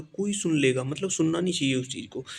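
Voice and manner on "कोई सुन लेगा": a bit louder, said openly, not hushed; the loud version, before the hushed one. Shown as a demonstration. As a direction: say it as if not caring who hears. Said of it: "उसमें जोर से बोलो".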